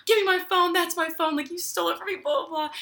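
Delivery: high-pitched imitation of mom yelling; mock screaming. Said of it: "She starts screaming at this man".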